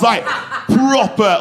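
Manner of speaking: usual speaking voice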